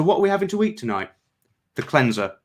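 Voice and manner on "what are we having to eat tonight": fast, high words tumbling out; words escaping before he can stop them; blurted slip. A narrator's reading. Cleanser blurting the phrase against his will, fast. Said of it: "covered his mouth".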